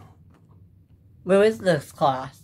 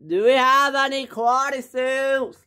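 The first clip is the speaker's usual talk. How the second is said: high, raised call to someone far off; 10 semitones higher than usual. Acted. Worker asking the boss about stock, calling out. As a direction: holler the question across the store.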